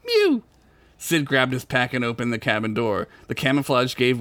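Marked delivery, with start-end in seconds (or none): 0.0-0.4 high squeaky kitten mew. Acted. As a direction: mew like a tiny cat. Cat voice in story.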